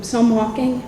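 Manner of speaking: ordinary talk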